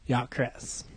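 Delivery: lightly voiced, easygoing drawl-free ask; Hannibal Buress voice. Comedian asking a shoe clerk for Jordans, soft mock voice. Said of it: "that's the same voice".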